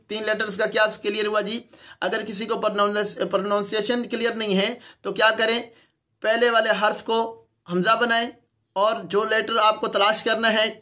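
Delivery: ordinary delivery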